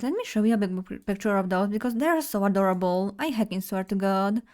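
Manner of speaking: usual speaking voice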